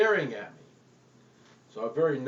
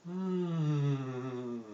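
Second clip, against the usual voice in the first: satisfied hmm. Acted. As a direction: hum like tasting a donut. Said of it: "this noise came out".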